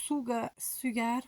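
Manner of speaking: essayer « Sugar » lentement, avec un accent français; accent français hésitant. Shown as a demonstration. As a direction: anglais tâtonnant à la française, voix basse. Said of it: "avec mon accent". (« Sugar » hésitant, à l'accent français)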